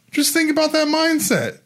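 high, marveling push; preaching wonder; excited. Real, not acted. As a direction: marvel at it aloud. Preaching enthusiasm rises.

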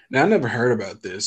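usual speaking voice

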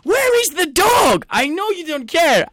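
high, slow, exasperated shouting; shouted rant. Real, not acted. Football fan ranting about an awful performance, shouting. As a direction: hold each stressed word out in a high shout. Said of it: "the thing that I wanna shout about".

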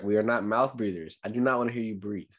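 normal talking voice

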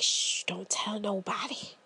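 low, soft hustler pitch in a hush; secretive whisper. Retold or imitated. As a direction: whisper the deal like a street dealer. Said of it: "And they like".

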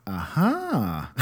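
mock teary toddler whimper; a kid's half-sob turning to a smile; imitating a child's sniffle. Son hearing about a sucker, mock teary whimper. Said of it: "he does this thing where he goes".

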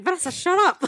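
high, loud urgent hush; loud, high retold telling-off; mock scolding. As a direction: scold a friend in alarm.

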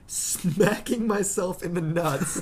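slow, drawn-out words breaking into laughter; laughing while reading. Real, not acted. Reader cracking up at the spider mishap, laughing. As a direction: read it through real laughter, stretching the words.

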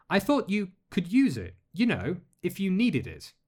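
put-on high, halting, embarrassed girl's voice; flustered young girl. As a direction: stumble over it, red-faced. Alex offering gift shyly.

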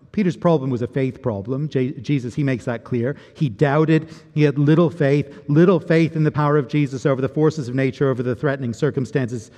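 ordinary talk

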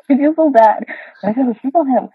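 giggling through an embarrassed confession; laughing. Real, not acted. Laughing while confessing she fell asleep on her husband.

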